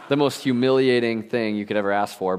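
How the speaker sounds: usual speaking voice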